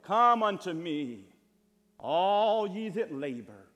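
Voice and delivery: very loud, long-stretched preacher's call; booming scripture proclamation. Acted. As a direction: cry the invitation out slowly to the whole congregation. Verse proclaimed after look at it.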